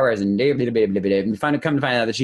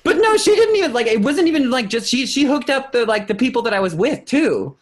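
gushing excitement; 14 semitones higher, 3 dB louder than usual. Real, not acted. Gushing about the kind exec.

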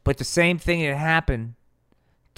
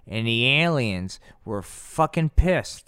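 ordinary talk, nothing marked

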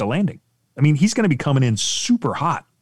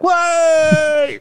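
very high, shouted what; mock shout; 5 dB louder than usual. Riders on top, mock startled shout. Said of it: "the guys on the top like".

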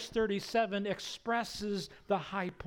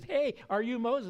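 louder, higher repeated question; insistent hail; 7 semitones higher, 5 dB louder than usual. Retold or imitated. Bush steps in front of the guy ignoring him, insistent hail. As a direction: call out to get an ignoring stranger's attention. Asks again, moving in front.

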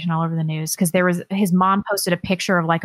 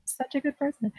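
high, soft, smug self-praise; sugary mock virtue; 8 semitones higher, 9 dB quieter than usual. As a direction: pat yourself on the back, ironically. Self-congratulating irony.

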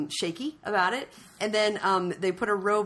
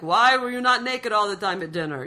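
put-on lightly done, wayward Dutch accent; mock Dutch accent, a bit louder; bad Dutch accent; 3 semitones higher, 6 dB louder than usual. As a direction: imitate a Dutch scolding, accent slipping eastward. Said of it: "was Amsterdam moved to Bulgaria suddenly?".

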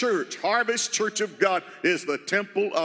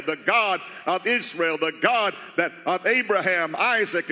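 usual speaking voice

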